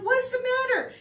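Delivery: high, loud retold alarm; alarmed sister. Sister answering her panicking brother, high-pitched alarm. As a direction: act out an alarmed question.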